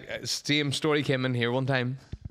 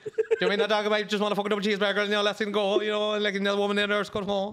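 higher-pitched, run-on traveller rant; 7 semitones higher, faster than usual. Acted. A traveller pleading with bouncers for his dog, mock indignant. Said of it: "If [name] was a traveller".

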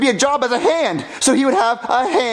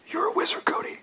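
awed whisper; 6 semitones higher, 10 dB quieter than usual. Acted. Awed realization.

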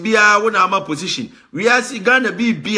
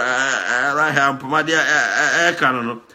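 normal talking voice

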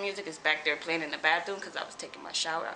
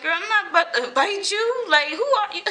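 higher and louder, laughing at her; incredulous laughing; 12 semitones higher, 7 dB louder than usual. Retold or imitated. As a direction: laugh off the fight challenge in disbelief. Retold reply to fight challenge.

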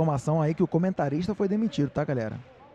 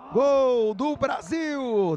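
stretched goal call, higher; explosive goal commentary; 7 semitones higher, much slower than usual. Real, not acted. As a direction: draw out the goal call with energy.

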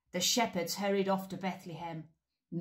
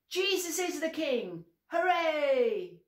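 high loud joyful cheer on Hooray; 9 semitones higher, 5 dB louder than usual. Shown as a demonstration. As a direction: cheer it out as loud as you can. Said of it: "Let's practice".

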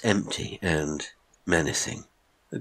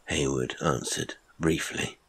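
ordinary delivery

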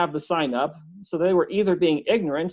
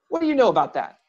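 sharp demand; 4 semitones higher, faster than usual. A narrator's reading. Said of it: "Benjamin demanded sharply".

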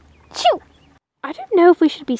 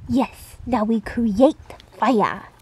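ordinary talk, nothing marked

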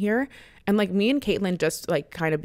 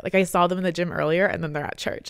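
normal talking voice

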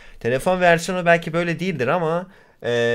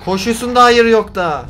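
raised, higher-pitched scolding; exasperated; 8 semitones higher, 5 dB louder than usual. Real, not acted. Gamer berating the game character for its noises, exasperated. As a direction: scold loudly in disbelief.

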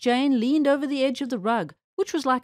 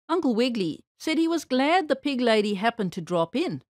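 ordinary delivery

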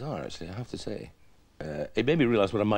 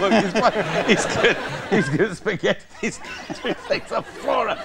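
high, loud mock hilarity; tickled onlooker; 7 semitones higher, 3 dB louder than usual. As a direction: imitate fans cracking up over nothing. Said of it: "everything he did was funny".

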